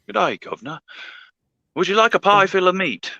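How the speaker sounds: high hammed-up British accent; mock British accent. Joking about England in a high mock British accent. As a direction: play a cartoon Englishman offering pie. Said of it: "they talk with the accent".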